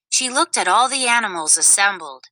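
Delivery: ordinary delivery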